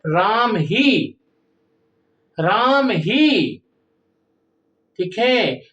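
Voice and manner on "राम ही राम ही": very slow राम ही with ही leaned on; very slow, ही stressed. Shown as a demonstration. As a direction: drag it out and push ही. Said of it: "ही शब्द से बल दिया जा रहा है".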